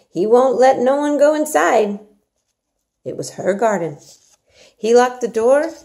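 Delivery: Yorkshire maid Martha. Acted. Martha urges Mary to eat her porridge, hearty and coaxing. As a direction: coax the child like a bossy country servant.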